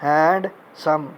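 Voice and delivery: "हैंडसम" pulled apart as हैंड, pause, सम; syllable breakdown. Shown as a demonstration. Teacher repeating for students to count syllables, "हैंडसम" split before सम. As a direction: say "हैंडसम" as two separate beats, हैंड and सम. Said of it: "दो, हैंड और सम".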